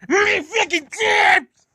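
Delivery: very high, slow, loud screech; furious scream. Acted. Mad tender engine ranting about the job, furious scream. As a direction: scream each word in outrage. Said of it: "they were very fucking mad".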